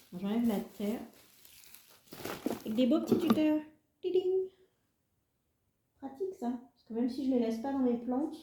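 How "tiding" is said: high sung little ding sound; vocal jingle sound. Pretend. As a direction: chime it like a notification. Voices a jingle while unboxing.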